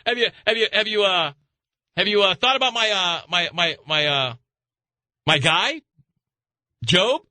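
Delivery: high, playful mock God voice; voicing God. God boasting to Lucifer about Job, in a high hammed-up voice. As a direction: ham up God bragging about his man Job, up high. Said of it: "He goes".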